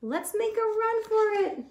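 very high urgent little voice; eager crayon. Acted. Red crayon urging the others to flee. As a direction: hatch the escape plan. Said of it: "said the red crayon".

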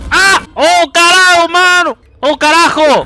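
loud scared cursing; startled yelling. Real, not acted. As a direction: yell it in fright. Jump scare in horror game.

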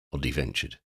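ordinary delivery

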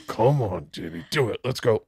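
intent quiet urging. Retold or imitated. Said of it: "I sat so intently ... going".

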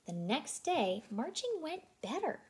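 usual speaking voice

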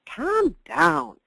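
imitating O'Reilly. TV host ordering his guest to calm down, mock stern. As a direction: bark it like a TV host.

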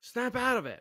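high-pitched acted urging; mock shaking a friend out of a daze; urgent wake-up call. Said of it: "taking a friend and shaking him".

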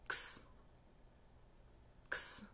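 "X" as a short soft "ks"; quick sound. Shown as a demonstration. Teacher quizzing long or fast, "X" as quick "ks". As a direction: release "X" in a brief unvoiced burst. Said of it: "That was fast".

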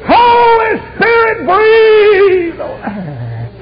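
high, very slow preacher's shout; loud, soaring cry to heaven; full-force invocation. Retold or imitated. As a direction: belt out the prayer, stretching each word. Same prayer repeated at full force.

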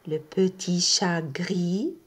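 ordinary talk

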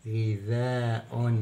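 غذاء drawn out slowly on the ذا; long alif. Shown as a demonstration. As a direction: stretch the long aa after the ذ in غذاء. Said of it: "كلمة فيها ذال ممدودة". (مدّ الألف بعد الذال في غذاء ببطء)

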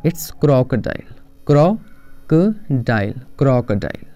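crocodile said KROK-a-dile, clear short o; teacher drilling the word; correct pronunciation, drilled. Shown as a demonstration. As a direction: crocodile stretched very slowly. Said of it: "क्रोकोडाइल नहीं".

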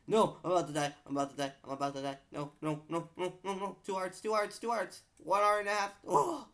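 high loud panic; panicked crying out. Real, not acted. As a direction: cry out near death. Nearly dying, low hearts.

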